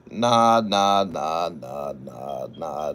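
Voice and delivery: bat it away, sinking in pitch and volume; dismissive repeated denial. Real, not acted. Host rebutting a viewer's robbery claim, dismissive and tumbling downward.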